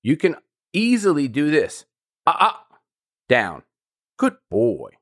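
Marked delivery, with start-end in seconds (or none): 2.2-5.0 the right way, calmly correcting the puppy; calm and subtle. Shown as a demonstration. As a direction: settle the puppy with a calm, even voice. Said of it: "Make it very subtle. Make it very calm".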